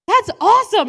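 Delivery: high, very loud burst of delight; excited shout. Retold or imitated. Brother cheering her joke, loud and high. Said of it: "is like".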